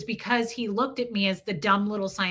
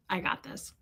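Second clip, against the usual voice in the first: whispered aside; 3 semitones lower, 9 dB quieter than usual. Real, not acted. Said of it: "unvoiced aside".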